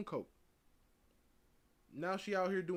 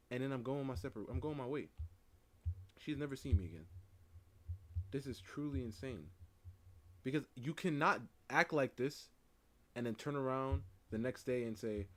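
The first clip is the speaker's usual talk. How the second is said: ordinary delivery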